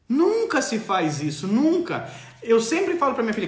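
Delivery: ordinary talk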